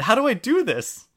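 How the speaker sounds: high, loud eager question; excited. Retold or imitated. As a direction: ask it like an eager beginner. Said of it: "So excited".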